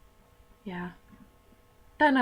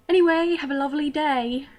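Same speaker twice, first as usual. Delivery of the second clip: higher, louder put-on British sign-off; British accent; 6 semitones higher, 7 dB louder than usual. Vlogger signing off to viewers, in a put-on British accent. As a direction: chirp a farewell in a fake British accent.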